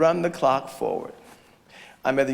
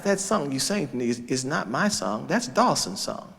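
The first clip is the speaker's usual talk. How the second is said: ordinary delivery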